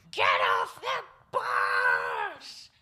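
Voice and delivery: low, loud, slow furious bellow; angry shout. Retold or imitated. Angry driver ordering the kids off the bus, low slow bellow. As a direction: roar at them to get out. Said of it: "the driver was really angry with us".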